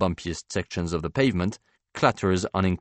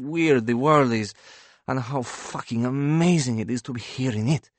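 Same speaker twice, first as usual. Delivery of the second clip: painter's higher voice, full of wonder; rapturous; 5 semitones higher than usual. A narrator's reading. Painter seizing banker's arm over beloved paintings, rapturous. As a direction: let rapture build as the painter, pitch lifted. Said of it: "become rapturous and light-filled".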